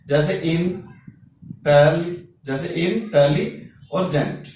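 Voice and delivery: "इन", "tele", "gent" each set apart by a pause; syllable by syllable. Shown as a demonstration. Teacher showing one vowel sound per syllable, "इन, tele, gent" in separate beats. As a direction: say "tele और gent" slowly, part by part. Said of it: "उसे एक syllable कह देते हैं".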